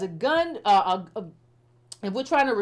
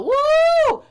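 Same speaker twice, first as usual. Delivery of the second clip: a high, loud, hammed-up cheering whoop; mock crowd whoop; 8 dB louder than usual. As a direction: whoop like a fired-up crowd, teasing.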